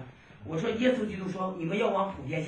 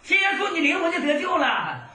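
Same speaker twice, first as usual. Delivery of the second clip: high, loud street shout calling to condemned men; urgent high preaching shout; retold shouting; 12 semitones higher, 6 dB louder than usual. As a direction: shout it across the crowd. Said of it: "他使劲这么喊".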